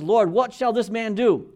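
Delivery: solemn scripture reading. Acted. Peter's question read from scripture.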